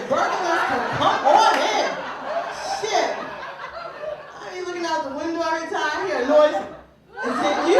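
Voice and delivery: loud, laughing calls. Real, not acted. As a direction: call out eagerly. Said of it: "hoping for burglar".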